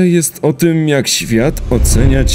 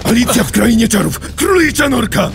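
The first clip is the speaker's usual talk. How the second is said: high-pitched, exasperated clarification; frantic explaining; 9 semitones higher than usual. Acted. Legoshi explaining his garbled reference, frantic and high. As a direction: blurt the explanation, pitch climbing.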